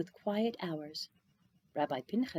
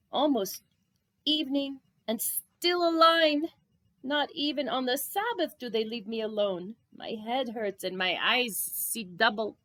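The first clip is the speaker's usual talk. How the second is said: put-on tired old rabbi, groaning about the line; weary rabbi complaining; 3 semitones higher, 4 dB louder than usual. Rabbi to himself at the window, tired and complaining. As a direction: sigh it out, worn down and put upon.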